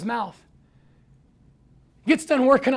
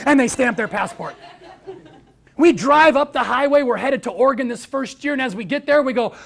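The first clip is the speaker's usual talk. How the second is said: ordinary talk, nothing marked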